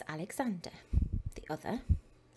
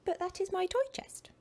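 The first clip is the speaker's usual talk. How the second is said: put-on indignant boy; 10 semitones higher than usual. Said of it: "Nathan replied".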